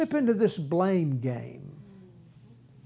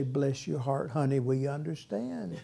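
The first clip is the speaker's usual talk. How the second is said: softer sugary consolation, mocking; mock soothing; 6 semitones lower, 9 dB quieter than usual. Friends console the whiner, mock-sweet and softer. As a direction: pat them on the head with your voice.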